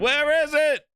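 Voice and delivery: high, loud insistent question; mock demanding shout. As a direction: keep pushing for it, voice up high. Demanding his PlayStation.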